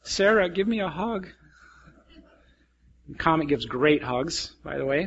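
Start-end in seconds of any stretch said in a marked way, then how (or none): 0.0-1.3 lightly voiced pet dog, softer; a hint of a begging dog. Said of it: "I often supply Comet's voice".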